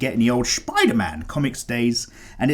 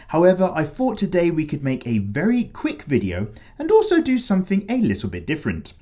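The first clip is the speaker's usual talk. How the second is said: normal talking voice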